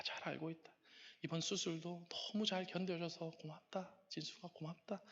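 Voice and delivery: very soft, slow, tender reassurance; God's consoling voice. Retold or imitated. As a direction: speak gently into someone's heart, hushed and unhurried. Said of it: "마음속에 이렇게 말씀하시더라고요". (나직하고 느리게 다독이는 하나님 음성)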